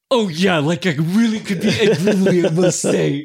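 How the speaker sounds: high, laughing Ed Grimley. Pretend. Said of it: "not a good one".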